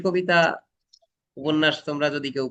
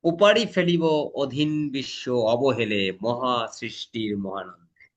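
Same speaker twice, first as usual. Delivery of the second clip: slow, lower, solemn recital; poem recitation; 3 semitones lower, slower than usual. Acted. Teacher reciting the poem to the class. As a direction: declaim the verse slowly and gravely.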